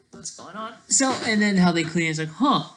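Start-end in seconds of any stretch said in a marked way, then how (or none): none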